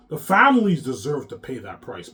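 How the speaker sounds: shouting it out angrily; loud, bitter outburst; raging that they deserve it; furious shout. Real, not acted. Angry rant at his enemies.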